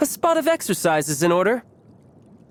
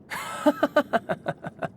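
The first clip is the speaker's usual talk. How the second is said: a short, breathy amused laugh; airy chuckle; 10 semitones higher, 5 dB quieter than usual. Acted. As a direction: scoff it off with a light chuckle. Amused by being called no match.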